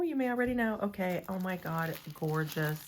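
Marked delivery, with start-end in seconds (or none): 0.0-0.8 teasing sing-song. Pretend. Teasing hint to viewers.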